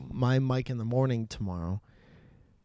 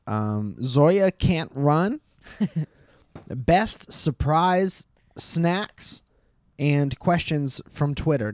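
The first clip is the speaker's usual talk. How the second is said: slow deliberate reading; 3 semitones higher, much slower than usual. Acted. Reading out the poll choices.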